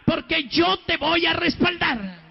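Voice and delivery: preacher's ringing assurance; retold forceful promise. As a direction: proclaim it with drawn-out force.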